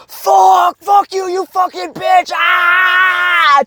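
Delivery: high, loud raging yell; furious scream. Shown as a demonstration. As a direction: scream the rage out. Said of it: "So you go from being".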